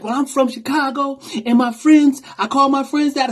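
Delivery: defensive woman driver. Retold or imitated. Driver justifies the slur.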